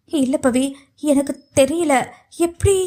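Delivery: halting, faltering words on the edge of tears; trembling, tearful. Acted. Accused young woman defending herself to Pavi, trembling and tearful. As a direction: stammer it out, pitch climbing at the end. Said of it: "நடுங்கிய குரலில்".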